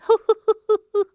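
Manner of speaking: high, loud cackling laugh turning into hoots; mocking laughter. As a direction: cackle at the old woman, rising to hoots. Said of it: "the girl went on laughing".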